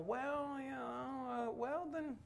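small, high, quiet hedging; drawn-out, noncommittal mock mumble; hesitant evasion. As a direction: stall sheepishly, high and soft. Evasive answer when asked about Bible.

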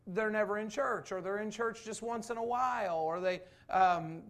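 ordinary delivery

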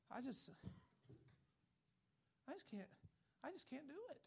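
high, whiny pleading voice; helpless whiner. Retold or imitated. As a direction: whine like you've given up. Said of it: "You know who talks like that?".

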